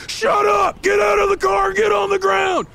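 high, forceful police orders; yelled commands at top pitch; shouting cop. Acted. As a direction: shout down the suspect. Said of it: "Instead, he shouts back".

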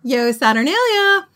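high, loud cheer; exuberant, jolly shout. Acted. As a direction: shout the greeting back merrily. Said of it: "equally exuberant and super jolly".

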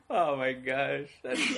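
normal talking voice

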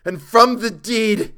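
high, very loud frantic outcry; hysterical scream. A narrator's reading. Chalmers telling Frank of the deed, screaming hysterically. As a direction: cry it out in mounting horror. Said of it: "His voice had risen to a scream".

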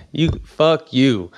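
mock-angry refusal, stretched out; retold defiance. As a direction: curse him off slowly, with weight.

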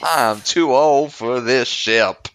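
slow, loud, exaggerated Southern drawl; mock old Southern cowboy growl; deep South accent. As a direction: ham up a Southern accent, dragging each word. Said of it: "With his really deep South accent".

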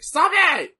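high, loud disgusted cry; annoyed yell. Real, not acted. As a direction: yell stop it, fed up. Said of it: "I hate it so fucking much".

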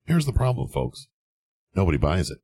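ordinary delivery

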